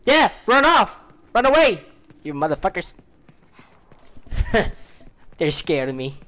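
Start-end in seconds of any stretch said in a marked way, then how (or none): 0.0-1.8 higher, louder jeer; taunting shout. Real, not acted. Player jeering at fleeing enemies, loud taunting shout. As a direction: yell after them mockingly.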